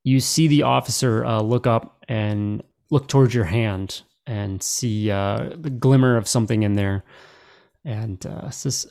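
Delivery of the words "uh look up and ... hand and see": ordinary talk, nothing marked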